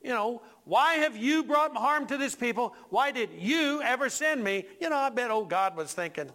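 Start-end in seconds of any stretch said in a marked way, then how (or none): 0.6-4.6 higher-pitched, accusing demand; ticked-off Moses. Acted. Preacher as Moses demanding answers from God, ticked off. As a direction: put God on the spot with stressed questions. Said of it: "Moses has got a real issue here".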